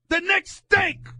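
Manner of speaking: loud high shout of disgust; angry shout. Real, not acted. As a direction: hammer it home at the top of your voice. Host rants, shouting verdicts.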